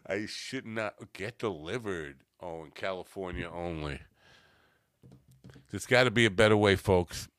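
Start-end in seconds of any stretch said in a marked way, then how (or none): 5.7-7.3 louder, fast TV-ad pitch; infomercial pitch. Pretend. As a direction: sell it like a late-night infomercial host. Infomercial catchphrase to viewers.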